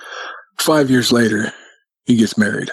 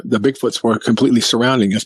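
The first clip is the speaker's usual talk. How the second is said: normal talking voice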